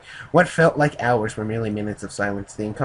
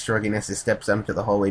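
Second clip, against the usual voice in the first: usual speaking voice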